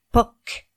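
sudden vocal pop of a cork. A narrator's reading.

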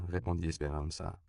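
ordinary delivery

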